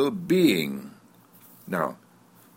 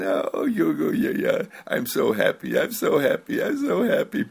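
put-on cooing baby, pitch climbing higher on each "I'm so happy"; 6 semitones higher than usual. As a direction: coo happily like a contented baby. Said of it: "It just builds and coos".

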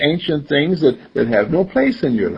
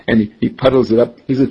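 ordinary talk

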